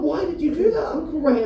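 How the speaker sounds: high, incredulous question; disbelieving, up high; high shocked why. Retold or imitated. Shocked nephew questions uncle.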